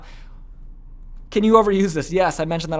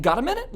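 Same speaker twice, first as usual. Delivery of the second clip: high upward lift on "Got a minute"; reasonable man; 10 semitones higher than usual. Shown as a demonstration. Teacher repeating the caller's ask, "Got a minute" rising high. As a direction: swing "Got a minute" up as an easy request. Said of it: "with that tonality".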